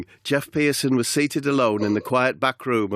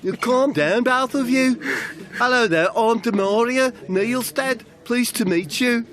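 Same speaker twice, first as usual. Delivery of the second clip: much higher, bright-toned hostess voice; chirpy Demaria; 13 semitones higher than usual. Acted. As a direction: fuss over him like a cheerful waiter. Plays Demaria, cheery host.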